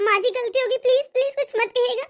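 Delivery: usual speaking voice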